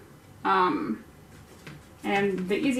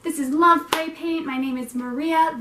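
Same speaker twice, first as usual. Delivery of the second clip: broadcaster voice; 6 semitones higher than usual. Shown as a demonstration. Host retaking her intro in broadcaster voice. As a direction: read it like a radio host. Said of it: "broadcaster voice".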